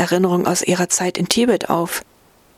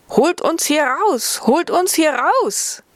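high, slow, pleading calls from the oven; more urgent bread cries; 9 semitones higher, slower than usual. Acted. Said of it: "jedoch nun heftiger".

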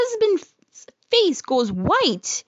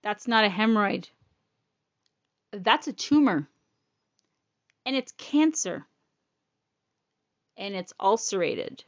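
somber; 5 semitones lower, 4 dB quieter than usual. Retold or imitated. Doctor's grim diagnosis quoted.

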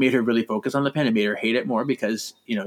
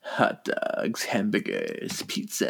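sultry whisper; 5 semitones lower, 8 dB quieter, much slower than usual. Pretend. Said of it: "you're so dirty".

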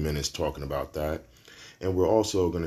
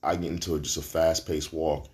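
usual speaking voice

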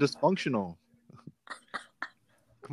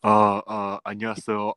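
ordinary talk

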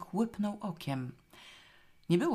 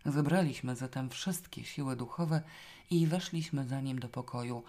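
ordinary delivery, nothing marked

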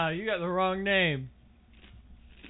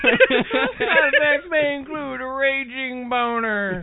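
loud, slow, higher announcer disclaimer; mock ad disclaimer; 4 semitones higher, 7 dB louder, slower than usual. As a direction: read the side effects like a hammed-up commercial. Parody drug ad disclaimer.

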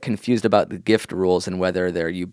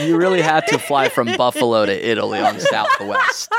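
ordinary talk, nothing marked

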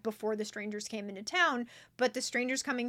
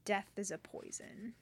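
very soft, ominous delivery; quiet, grave dragon line; hushed dragon; 2 semitones lower, 11 dB quieter than usual. Acted. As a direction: say it almost under your breath. Reading the dragon's line aloud.

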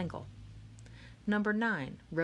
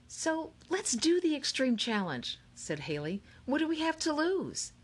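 higher, lightly voiced eager girl; 9 semitones higher than usual. A narrator's reading. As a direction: push the idea with cheerful gusto. Haley voiced by narrator.

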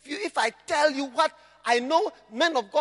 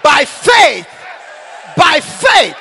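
loud fiery proclamation; loud preacher's declaration; shouted preaching; 5 semitones higher, 12 dB louder than usual. Real, not acted. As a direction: proclaim it at full force. Preaching climax.

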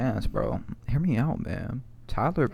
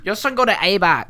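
high, loud broken-English brag; mock immigrant parent voice; 7 semitones higher, 7 dB louder than usual. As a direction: shout the boasts in a put-on accent. Continues the accent bit.

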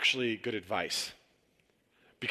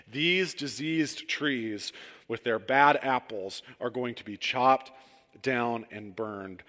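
ordinary delivery

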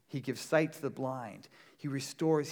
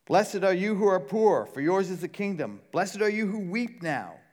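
preaching; 5 semitones higher, 9 dB louder than usual. Acted. Preacher quoting the Beatitudes.

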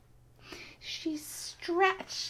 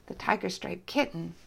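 ordinary delivery, nothing marked

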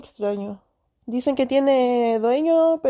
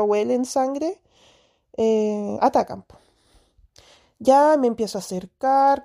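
normal talking voice

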